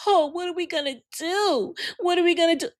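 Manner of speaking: high mocking whiny voice; hammed-up fretting liberal; mock panic.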